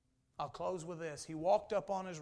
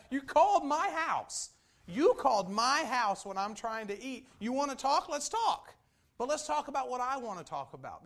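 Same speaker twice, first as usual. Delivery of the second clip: indignant high, loud retort to a caller; indignato; 7 semitones higher, 10 dB louder than usual. Acted. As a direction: fire back loudly at a telemarketer, acting fed up. Mock retort to telemarketers calling at supper.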